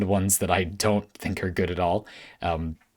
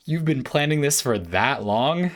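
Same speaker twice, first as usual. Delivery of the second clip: slow, higher-pitched incredulous question; amazed disbelief; 6 semitones higher than usual. Acted. Viewer reacting to a twist after hundreds of episodes, amazed. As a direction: draw it out as if stunned by the reveal.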